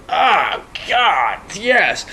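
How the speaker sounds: high, swooping delight; retold ecstasy. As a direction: savour it with acted-out bliss. Acting out delight at fuel smell.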